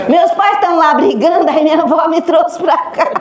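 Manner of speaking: put-on laughing while quoting the child. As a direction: laugh through the boy's words. Grandson voiced again, laughing.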